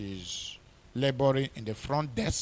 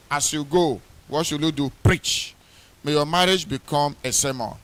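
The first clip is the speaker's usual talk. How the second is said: loud, ringing pulpit preaching; fervent wedding sermon preaching; 3 semitones higher, 7 dB louder than usual. Acted. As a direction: preach it out with full force. Preaching at full force to couple.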